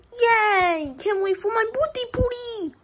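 put-on high, squealing little-boy joy; excited cheer. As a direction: cheer like a kid who just got his way. Balloon Boy cheering about party.

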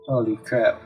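ordinary delivery

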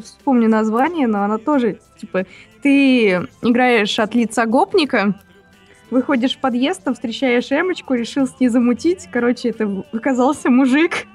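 normal talking voice

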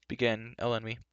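plain reading voice

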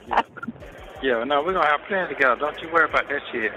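ordinary talk, nothing marked

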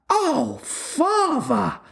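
put-on high, scandalised girl's cry; hammed-up shocked child's exclamation; exclaimed. A narrator's reading. Wendy appalled at her father's trick, exclaimed. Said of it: "Wendy exclaimed".

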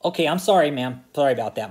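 ordinary delivery

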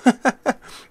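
sudden high loud laugh; loud burst of laughter. Real, not acted. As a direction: crack up laughing.

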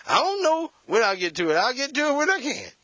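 high, loud whiny excuse; mock lazy spouse. Preacher voicing a spouse dodging the dishes, high and whiny. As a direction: put it off like a sulky mate.

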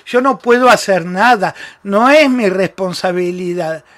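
normal talking voice

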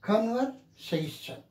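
slow "Conversation", main stress on "-sa-"; teacher's model "Conversation", con-ver-SA-tion; primary and secondary stress. Shown as a demonstration. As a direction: stretch "Conversation" and lean on "-sa-". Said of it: "This is primary".

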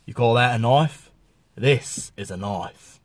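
low Australian film-hero drawl, slowing at the end; mock Australian accent, slow and low on the payoff; Crocodile Dundee. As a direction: deliver it as a cool Aussie tough. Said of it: "like they say in Crocodile Dundee".

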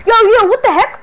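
loud, high startled yell; shocked outburst at an intruder. Acted. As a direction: jump at the sight of her.

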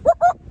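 very high, loud siren imitation; two loud high whoops like a siren; police siren whoop. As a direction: mimic a cop car siren.

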